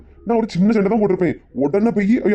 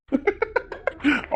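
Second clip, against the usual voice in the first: high mocking cackle; 10 semitones higher, 8 dB quieter than usual. Father laughing at the wife caught eavesdropping, high cackle. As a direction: cackle in triumph.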